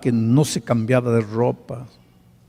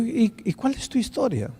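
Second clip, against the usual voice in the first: gentle invitation; 10 semitones higher, 7 dB quieter than usual. Retold or imitated. Pastor softly invites his story.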